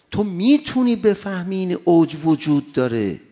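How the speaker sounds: loud sermon pushed hard; full-force preaching. Acted. Preacher urging the congregation toward faith, loud and insistent. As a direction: drive the point home from the pulpit.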